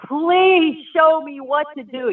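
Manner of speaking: high-pitched, imitating a hysterical cry for help; a touch of recalled panic in a high plea. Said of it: "in my hysterical voice".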